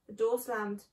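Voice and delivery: usual speaking voice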